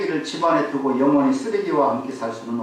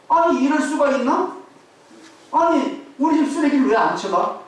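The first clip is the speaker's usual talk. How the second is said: indignant outburst; 8 semitones higher than usual. Retold or imitated. Isser fumes when the garbage truck skips his house, indignant. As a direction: act out his disbelief that his trash was skipped. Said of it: "그러면서 막 화가 났어요".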